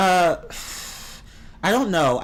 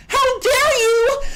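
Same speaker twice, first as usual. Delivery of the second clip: very high, slow, theatrical gasp with a laugh; over-the-top offended falsetto; mock outrage; 5 dB louder, much slower than usual. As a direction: squeal it like a wounded drama queen. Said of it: "you're like".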